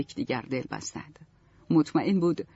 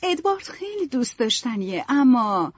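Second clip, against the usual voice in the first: put-on higher, hesitant girl's voice; hesitant young Marianne; 7 semitones higher than usual. A narrator's reading. Marianne doubts Edward to her mother, hesitantly. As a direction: trail off unsure on the but. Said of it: "ماریان با کمی تردید گفت".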